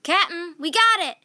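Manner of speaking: loud high excited shout; pirate shouting with triumph. A narrator's reading. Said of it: "shouted Pinky".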